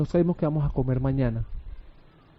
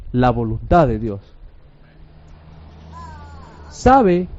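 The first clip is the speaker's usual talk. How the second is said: ordinary talk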